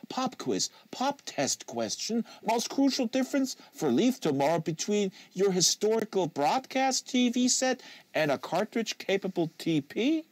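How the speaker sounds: high, rising game-show host; eager quizmaster. Acted. Trelch quizzing the locker room, high and showy. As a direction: fire off the quiz like a TV host.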